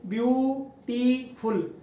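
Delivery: Beautiful in three separate beats, ब्यू-टी-फुल; syllable by syllable. Shown as a demonstration. Teacher showing students tongue jerks, beautiful syllable by syllable. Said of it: "जब मैं बोल रहा हूँ, देखें".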